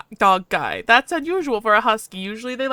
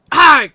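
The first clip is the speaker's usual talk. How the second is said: sudden sharp yelp of pain, much louder and higher; 6 semitones higher, 14 dB louder than usual. Acted. Shocked by the software, a loud pained yelp. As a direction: jolt out a yelp as the shock hits. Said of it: "I yelp loudly".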